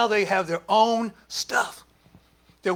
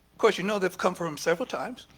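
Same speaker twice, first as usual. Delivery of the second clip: ordinary talk, nothing marked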